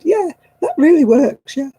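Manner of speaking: high loud sarcastic yeah right. Real, not acted. Answering glib advice with high sarcasm. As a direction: roll your eyes out loud.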